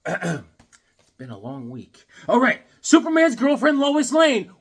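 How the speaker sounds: normal talking voice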